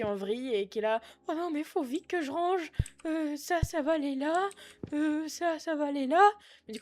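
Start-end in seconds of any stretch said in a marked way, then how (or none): 1.3-6.3 higher, flustered shop worker rushing to tidy; panicking cashier in a hurry; a higher voice for Josiane, the worker, as everything goes wrong. Acted. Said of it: "par exemple, euh, Josiane".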